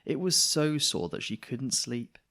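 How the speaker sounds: ordinary delivery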